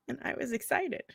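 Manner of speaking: ordinary talk, nothing marked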